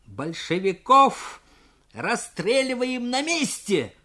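high, slow, menacing cry; threatening shout. Acted. Junker threatening to shoot Bolsheviks on the spot, slow menacing shout. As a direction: shout the threat, each word heavy. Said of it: "угрожающе крикнул юнкер".

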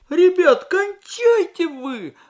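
high, slow pleading whine; whiny groan. A narrator's reading. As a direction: moan it like a worn-out small cadet. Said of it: "простонал откуда-то сзади голосок".

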